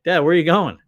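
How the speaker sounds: worried son calling to his wandering father; calling out to dad. Retold or imitated.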